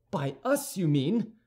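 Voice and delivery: high, challenging query; indignant cry. A narrator's reading. D'Artagnan challenging Aramis, crying out. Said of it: "cried D'Artagnan".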